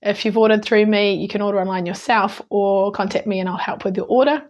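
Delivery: ordinary talk, nothing marked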